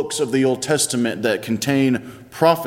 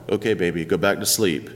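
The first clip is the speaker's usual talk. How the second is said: put-on softer, lower, soothing a scared child at night; sleepy dad calming his daughter, softly; 6 semitones lower, 3 dB quieter, faster than usual. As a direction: hush her gently back to bed.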